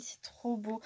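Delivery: hushed admiration; whisper. Real, not acted. Thanking the sender in an awed whisper. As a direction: let it out on a whisper.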